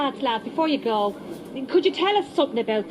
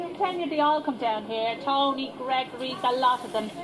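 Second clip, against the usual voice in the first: ordinary delivery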